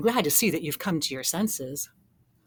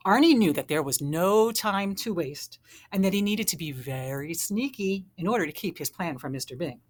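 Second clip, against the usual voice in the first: plain reading voice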